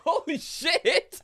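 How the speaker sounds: high, loud, drawn-out exclamation; real laughing astonishment. Real, not acted. Seeing the absurd Zen kanji, laughing shout. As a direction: shout it slowly through laughter.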